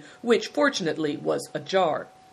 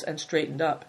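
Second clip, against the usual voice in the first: ordinary delivery, nothing marked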